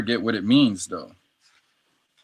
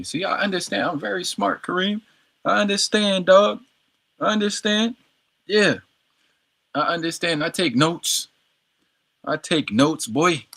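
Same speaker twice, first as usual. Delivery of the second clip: cocky boast; 8 semitones higher than usual. Real, not acted. Boasting to his tutor Kareem.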